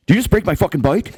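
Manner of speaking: high-pitched, indignant retold challenge; mock angry. Cyclist confronting the man who broke his bike, mock angry. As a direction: act out outraged disbelief. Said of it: "'cause I'm angry".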